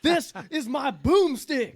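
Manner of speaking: slow, high, loud hero boast; Ash boomstick line. Retold or imitated. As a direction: drawl it like a cocky hero. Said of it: "I like".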